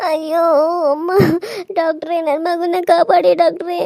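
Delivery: groaning in pain, begging between moans; labour pain moans. Acted. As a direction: moan through stomach cramps. (ಹೆರಿಗೆ ನೋವಿನ ನರಳಾಟ)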